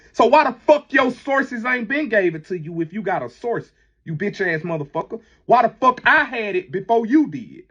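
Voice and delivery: indignant, high-pitched demand; heated challenge. Real, not acted. Calling out a rival over his claimed sources, indignant. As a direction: fire the questions angrily, voice riding high.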